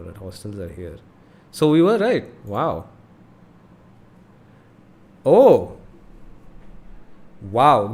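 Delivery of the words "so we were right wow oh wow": loud, thrilled exclamations; excited. Real, not acted. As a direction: burst out with delight. Finds the hostels, exclaims.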